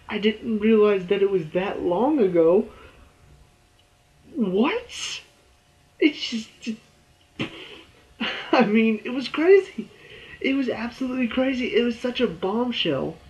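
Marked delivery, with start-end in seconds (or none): 4.3-5.2 high, drawn-out disbelieving rising "What?"; stunned by the twist; incredulous what. Real, not acted. Reacting to plot twist. 6.0-13.2 high-pitched, giddy disbelief; excited disbelief. Real, not acted. Excited about the bombshell.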